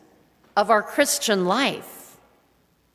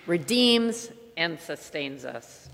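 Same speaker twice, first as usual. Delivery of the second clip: prayerful invocation; 4 semitones lower than usual. Acted. Preacher opening the sermon with a solemn prayer. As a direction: intone it like a blessing.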